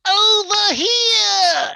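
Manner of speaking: very high, long drawn-out droid shout; C-3PO. Retold or imitated. Droid calling people over, loud high drawn-out cry. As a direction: call out shrilly, stretching every syllable. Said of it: "just like the way three P O said it".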